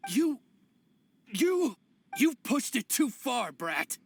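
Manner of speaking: stammer, then burst out in anger; angry outburst. Acted. Exposed bandit turns on accuser, angry sputtering.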